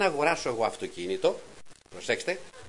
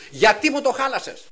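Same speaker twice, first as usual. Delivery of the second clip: complaining to God; 10 semitones higher, 8 dB louder than usual. Retold or imitated. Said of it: "είπα στον Κύριο".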